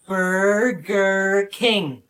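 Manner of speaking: Burger King drawn out word by word, very slow; very slowly. Acted. Asked to pronounce it slowly, drawls Burger King very slowly. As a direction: stretch every syllable of Burger King like a cry.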